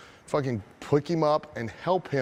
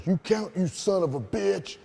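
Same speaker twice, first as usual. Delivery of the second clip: high-pitched mock heckle; imitated angry spectator; irate audience member; 6 semitones higher than usual. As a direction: stand up and demand it from the crowd. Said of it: "a guy in the audience goes".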